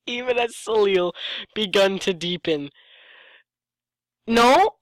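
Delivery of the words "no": exasperated no. Real, not acted. Correcting the story's grammar.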